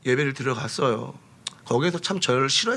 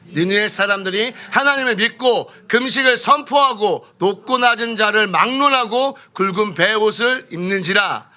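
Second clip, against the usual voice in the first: slow, emphatic public Bible reading; scripture reading; 5 semitones higher, 4 dB louder than usual. Real, not acted. Preacher reading the passage to the congregation, solemn emphatic recitation. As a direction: read it out firmly, word by word.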